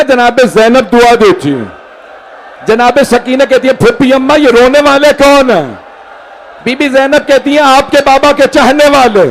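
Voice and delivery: put-on a touch of a child's lament; high-pitched mourning recitation. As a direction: plead it like a grieving girl. Child Sakina's words in the lament.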